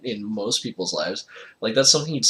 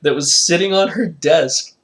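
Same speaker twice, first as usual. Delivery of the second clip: laughing; 7 semitones higher, 7 dB louder than usual. Real, not acted. Laughing through the retelling.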